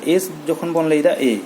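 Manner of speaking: ordinary talk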